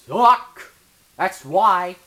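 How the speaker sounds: high, loud yell at the screen; frustrated shout. Real, not acted. Gamer yelling at his companion, loud and high. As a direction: bellow it at the useless partner.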